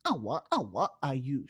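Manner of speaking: Awa, Awa scratched, then Ayush; vocal scratch pattern. Shown as a demonstration. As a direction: scratch the name onto the beat. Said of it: "choose one of our scratch patterns".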